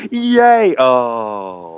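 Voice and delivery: troll someone with a fake cheer that deflates; mocking cheer turned letdown. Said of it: "they would all go".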